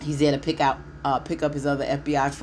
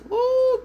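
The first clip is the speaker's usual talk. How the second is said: high, sudden whoop to hype the entrance; a quick excited whoop up high; hype woo; 4 dB louder than usual. Real, not acted. Viewer greets Gary's entrance with a high excited whoop. Hype cry introducing Gary.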